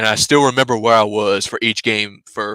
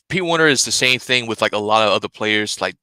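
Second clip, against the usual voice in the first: normal talking voice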